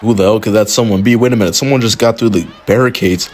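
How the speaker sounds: normal talking voice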